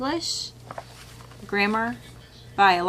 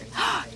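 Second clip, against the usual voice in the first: surprised gasp; 12 semitones higher than usual. Retold or imitated. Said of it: "a mom was like".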